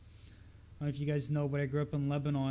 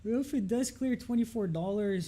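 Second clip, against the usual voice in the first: talking through a yawn; 8 semitones higher than usual. Real, not acted. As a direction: yawn stretching the words out. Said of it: "excuse me for yawning".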